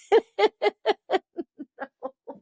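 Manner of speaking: long rising burst of high laughter; can't hold back a giggle. Real, not acted. Telling the story of the mix-up, breaking into high laughter.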